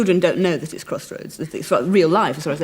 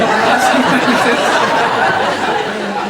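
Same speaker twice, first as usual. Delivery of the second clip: loud rolling laughter; sustained hearty laugh; long laugh; 3 semitones higher, 5 dB louder than usual. Real, not acted.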